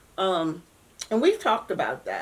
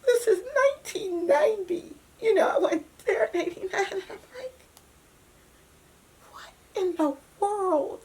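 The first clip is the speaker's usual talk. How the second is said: real crying; 12 semitones higher than usual. Real, not acted. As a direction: voice breaking into high tearful pitch while recounting. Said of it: "I'm crying now".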